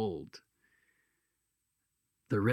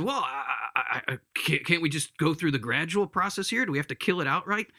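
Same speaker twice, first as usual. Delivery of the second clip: stammering, then higher and pleading; nervous stalling; 5 semitones higher, faster than usual. Acted. Ghost bargaining with the angel for a gradual process, nervous stalling. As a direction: stumble into a hesitant plea.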